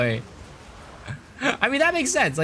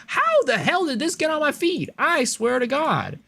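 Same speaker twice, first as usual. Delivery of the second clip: exasperated outburst; 10 semitones higher than usual. Acted. Streamer gripes about pimple videos on the feed, mock-exasperated outburst. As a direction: act appalled, pitch shooting up. Acts out outrage at his feed.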